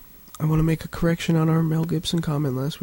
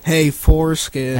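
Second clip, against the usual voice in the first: slow, louder drawn-out mock greeting; mock TV-show greeting; 6 dB louder, slower than usual. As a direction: stretch it like a sitcom character. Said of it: "For, foreskin".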